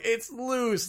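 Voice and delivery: high, loud mock panic; silly clown. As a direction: ham up the alarm. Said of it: "the guy who said".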